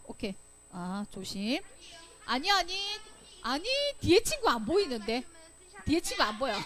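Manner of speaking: ordinary talk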